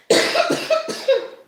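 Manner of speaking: real harsh coughing fit after laughing; asthma coughing fit. Real, not acted. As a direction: cough through a laugh-triggered asthma attack. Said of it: "I go into asthma attacks".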